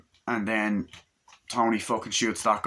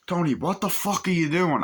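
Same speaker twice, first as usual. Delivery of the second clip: high-pitched outraged demand; a retold angry outburst; angry confrontation; 8 semitones higher than usual. Henchman confronting Tony, retold.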